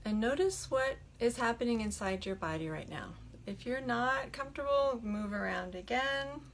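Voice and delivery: soft, unhurried meditation voice with long pauses; hushed, settling delivery, leaving room to breathe; guided meditation. Acted. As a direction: gently guide them into stillness. Leads a meditation.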